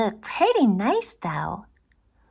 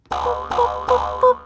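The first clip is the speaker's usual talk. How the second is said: high beeping "boop boop"; high electronic boop; button beeps; 14 semitones higher than usual. Acted. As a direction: squeak out a button sound. Button presses.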